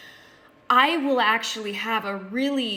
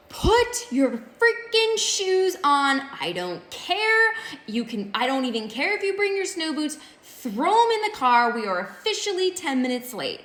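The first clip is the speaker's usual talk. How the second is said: yelling at son; 6 semitones higher, 3 dB louder than usual. Retold or imitated. Stressed mom yells at her son to put on his shoes, frantic. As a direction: snap at the kid like a frazzled mom at the end of her rope. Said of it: "decide it's a good idea to yell at my son".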